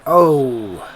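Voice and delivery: loud, drawn-out "oh"; startled outcry. Real, not acted. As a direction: blurt it out loudly as the play turns. Reacting to the play.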